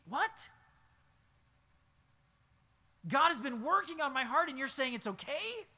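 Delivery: high, loud, stunned protest; indignant disbelief. Acted. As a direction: cry out in hurt disbelief. Wounded believer protests.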